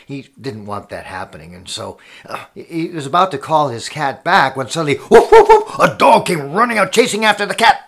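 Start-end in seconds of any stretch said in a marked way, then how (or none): 5.1-5.8 high, loud woofs; dog barking. Acted. Storyteller voices a dog chasing the cat, loud high barks. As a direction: bark like an excited dog.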